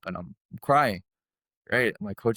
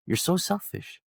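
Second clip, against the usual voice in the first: mock scolding in a much higher voice; retold coach yelling; 5 semitones higher than usual. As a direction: scold like an upset coach, pitched up. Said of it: "he was just yelling at me".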